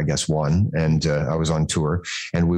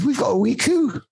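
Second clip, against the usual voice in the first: high, badly faked Scottish old lady; a wobbly mock Scottish shop lady; terrible Scottish accent. Shop lady fawning over the baby, in a hammed-up Scottish accent. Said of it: "channeling of Missus Doubtfire".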